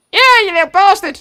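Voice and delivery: high, fast triumphant goblin cheer. Acted. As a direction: whoop it like a tiny goblin hero. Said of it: "I fucking love that voice".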